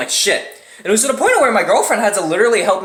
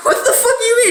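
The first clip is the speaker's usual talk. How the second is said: shocked squeal; faster than usual. Retold or imitated. Shocked reply to nurse.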